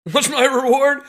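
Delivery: laughing repeat. Real, not acted. Plumber repeating his question with a bitter laugh. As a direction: laugh at the absurdity.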